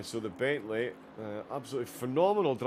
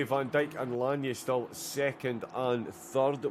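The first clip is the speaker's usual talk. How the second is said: usual speaking voice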